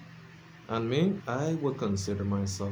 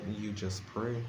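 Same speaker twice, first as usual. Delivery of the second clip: usual speaking voice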